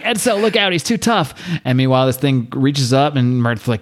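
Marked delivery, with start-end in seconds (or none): 0.0-1.3 mock alarmed warning. Retelling Kevin warning Edsel mid-fight, mock alarm. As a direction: imitate an urgent heads-up at a raised pitch. Retold shouted warning in battle.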